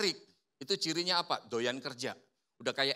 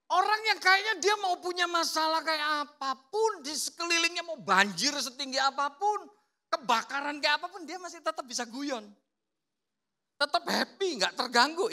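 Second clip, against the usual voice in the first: much higher, lively and cheerful; bright and upbeat; 10 semitones higher than usual. Shown as a demonstration. Preacher describing carefree sanguine people, high and cheerful. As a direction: bounce through it like nothing matters.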